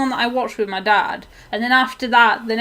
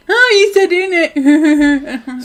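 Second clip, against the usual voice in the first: drawn-out, louder jeer, higher in pitch; mock teasing; 5 semitones higher, 8 dB louder, slower than usual. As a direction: imitate friends taking the piss, stretched out. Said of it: "they take the piss out of me".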